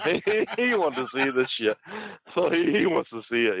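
helpless high-pitched laughter through the words; laughing while talking. Real, not acted. Storyteller cracking up over Honky Tonk Man staying to watch, laughing. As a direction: squeal it out through giggles.

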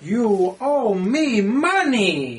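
slow, heavy mock Russian accent. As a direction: drag it out in a bad Russian accent. Mocking the bad Russian accent.

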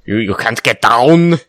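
loud, slow mock Austrian accent; Schwarzenegger impression. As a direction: bark it like a bad Schwarzenegger. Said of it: "I do a terrible Schwarzenegger".

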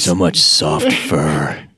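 low, slow creepy whisper-like voice; creepy slow drawl. Pretend. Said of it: "easy to do my creepy voice".

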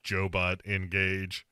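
toneless, mechanical deadpan; monotone machine; robot voice. Acted. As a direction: announce it like a robot powering on. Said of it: "he's been replaced by a robot".

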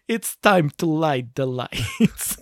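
slow, put-on Russian accent; deadpan Russian action-hero imitation, very slow; Constantine's Russian accent. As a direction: draw the words out in a mock Russian accent. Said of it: "dub the lines from, uh, Constantine".

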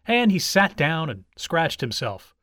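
ordinary delivery, nothing marked

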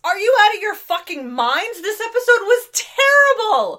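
loud, high, outraged exclaim. Real, not acted. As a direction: blast them with disbelief. Outraged disagreement with co-hosts.

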